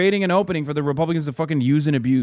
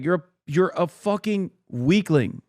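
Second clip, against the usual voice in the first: ordinary talk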